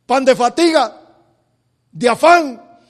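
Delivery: loud, ringing sermon delivery; fiery preaching. Acted. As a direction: preach it out to the congregation. Preacher at full force.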